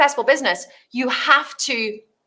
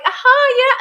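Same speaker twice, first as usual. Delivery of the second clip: awkward fake agreement; 13 semitones higher, 3 dB louder than usual. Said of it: "And you're like".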